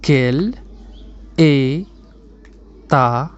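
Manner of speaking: very slow, each word set apart; emphatic teacher's breakdown, one piece at a time. Shown as a demonstration. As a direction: isolate every word with long pauses for learners.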